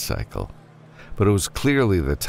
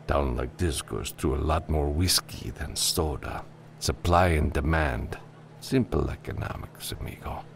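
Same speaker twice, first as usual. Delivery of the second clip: put-on softer, lightly accented barkeep; unbothered saloon bartender; 6 dB quieter than usual. Bartender justifying his price to a customer, softly unbothered.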